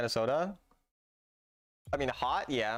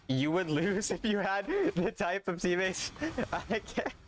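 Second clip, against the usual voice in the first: laughing; 7 semitones higher than usual. Real, not acted. Laughing at chatter's claim.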